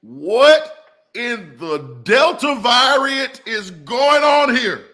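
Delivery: high, slow, drawn-out disbelief; shocked outcry. Pretend. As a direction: stretch it out like you can't believe it. Mock outrage at church sign.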